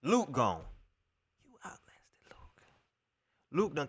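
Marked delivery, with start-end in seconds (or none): 1.4-2.6 barely audible, slow whisper; whispered aside. Real, not acted. Fan marvelling at who outlasted Luke, whispered. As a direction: drop to a slow, hushed whisper.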